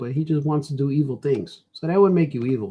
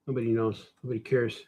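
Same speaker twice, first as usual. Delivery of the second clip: ordinary talk, nothing marked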